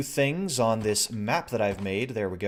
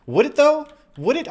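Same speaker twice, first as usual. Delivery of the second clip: skeptical; 8 dB louder than usual. Real, not acted. Doubting the item's use.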